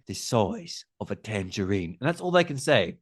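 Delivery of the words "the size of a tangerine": low attempt at a Cockney film-star accent; hammed-up Cockney accent; Michael Caine. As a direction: do a cheesy Cockney impression, pitched down. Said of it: "trying to do a Michael Caine".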